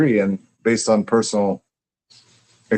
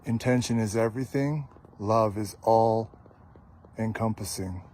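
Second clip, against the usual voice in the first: poem reading; 10 dB quieter than usual. Acted. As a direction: read each line gently, like a poem. Reads poem lines aloud.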